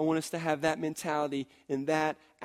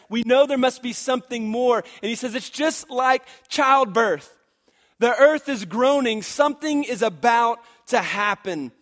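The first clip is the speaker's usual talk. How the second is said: raised, ringing sermon voice; preaching; 4 semitones higher, 6 dB louder than usual. Acted. Pastor rousing the congregation, fervent preaching. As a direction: preach it out with fervor.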